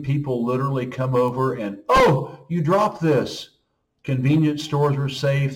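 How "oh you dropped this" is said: high, loud "oh", then easing down; helpful call-out. Retold or imitated. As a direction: imitate a passer-by flagging someone down. Acts out helpful passer-by calling out.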